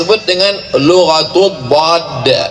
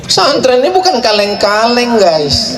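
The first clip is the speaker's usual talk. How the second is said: mock-boastful high voice; 7 semitones higher than usual. Boasting about the pesantren.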